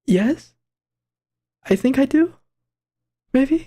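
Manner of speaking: tentative, rising questioning tone; unsure answer. Acted. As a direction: let each answer climb into a question. Hesitant reply, read in character.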